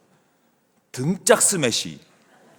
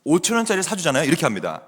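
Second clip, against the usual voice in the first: ordinary delivery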